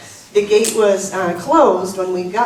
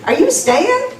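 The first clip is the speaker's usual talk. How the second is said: high, drawn-out Southern gate lady; Southern accent, high and rising; 13 semitones higher, 3 dB louder than usual. Retold or imitated. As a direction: imitate a Southern woman asking sweetly. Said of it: "the woman said in her southern accent".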